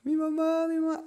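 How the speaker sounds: high, thin mock wail; imitated crying child. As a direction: wail softly like a lost little boy. (lloriqueo de niño, imitado)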